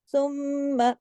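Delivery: सुम्मा with a long hummed म्म; big nasal hold. Shown as a demonstration. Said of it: "मीम पे गुन्ना होगा". (सुम्मा, बड़े गुन्ने के साथ)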